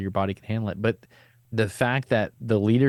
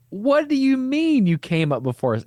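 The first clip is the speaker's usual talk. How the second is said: much higher and louder disbelief; incredulous question; 14 semitones higher, 4 dB louder than usual. Retold or imitated. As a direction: ask as if it can't be true.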